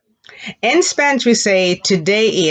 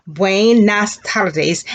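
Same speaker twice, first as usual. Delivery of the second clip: stretched out, slow and clear; slow classroom demo; slow teacher model; much slower than usual. Shown as a demonstration. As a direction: model it slowly for learners. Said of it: "good afternoon was".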